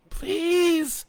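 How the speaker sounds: high, loud desperate plea; trying to shout. A narrator's reading. Injured woman begging the men above for help. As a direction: shout up for help with what's left. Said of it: "she tried to shout".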